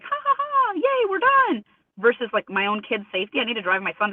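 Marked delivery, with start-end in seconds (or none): 0.0-1.6 squeaky high mocking cheer; mock gloating. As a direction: mimic his smug victory laugh, way up high. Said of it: "he can be like".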